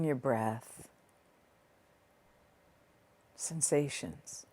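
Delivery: soft, unhurried meditation voice with long pauses; guided meditation. Real, not acted. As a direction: ease the listener gently inward, leaving space between phrases.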